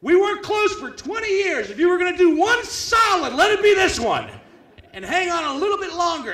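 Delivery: hammed-up furious shout to the sky; mock outrage shout. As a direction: rant at a dead dad for his timing. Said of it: "And I'm like".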